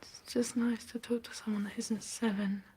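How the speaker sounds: soft weary whisper. Acted. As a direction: breathe it out, exhausted. Tired quiet confession.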